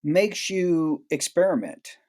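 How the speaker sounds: ordinary delivery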